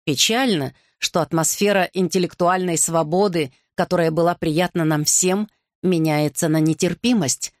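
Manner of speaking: ordinary delivery, nothing marked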